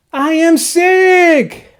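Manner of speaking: delighted. Acted. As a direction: savour the good news. Gerald relieved to have cold.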